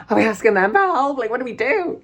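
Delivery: high-pitched panicky questions, acted out; retold panic. Stranded traveller asks partner about creepy men, mock panicked. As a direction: ask it in a mock high fluster.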